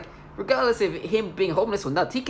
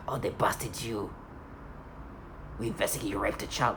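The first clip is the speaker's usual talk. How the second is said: mock accusing voice; 6 semitones lower, 8 dB quieter than usual. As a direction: put on their smug accusation. Imitating office staff accusation.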